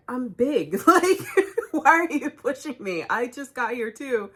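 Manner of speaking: high-pitched, half-laughing retold protest; indignant protest. Shopper protesting to people shoving her, indignant. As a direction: act out being put out, with a laugh breaking in. Acted protest at being shoved.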